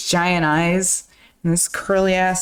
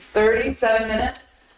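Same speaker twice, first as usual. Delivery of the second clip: ordinary delivery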